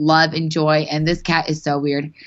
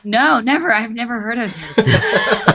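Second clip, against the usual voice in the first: mock-innocent denial; 5 semitones higher than usual. Joking denial of weird messages.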